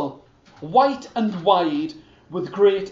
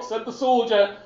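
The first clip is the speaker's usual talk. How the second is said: plain reading voice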